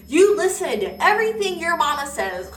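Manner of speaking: put-on high, loud country-accented exclamation. Older woman addressing the daughter from the stall, country accent. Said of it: "in her country accent".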